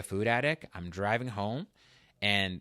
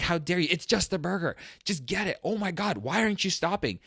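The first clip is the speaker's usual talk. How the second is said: replaying a raised, higher-pitched scolding of himself, half-laughing; angry at myself; 9 semitones higher, 4 dB louder than usual. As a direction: snap at yourself for not stopping at the burger place. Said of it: "I'm over the top angry at myself".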